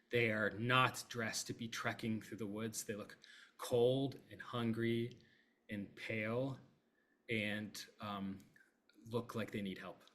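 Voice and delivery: ordinary talk, nothing marked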